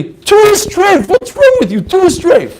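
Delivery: hammed-up outrage at the questioner; mock indignant rebbe. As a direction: act the rebbe shocked at a silly question. Rabbi scolds a foolish question.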